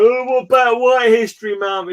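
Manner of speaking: high, exaggerated mocking protest; hammed-up objector, pitched well up; mock online complainers. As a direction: put on a high voice of petty indignation. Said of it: "people go".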